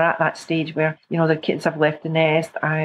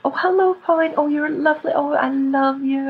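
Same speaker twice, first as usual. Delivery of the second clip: higher, tender self-praise; sweet mirror talk; 7 semitones higher than usual. Retold or imitated. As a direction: coo to yourself kindly. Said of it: "stand in the mirror and say".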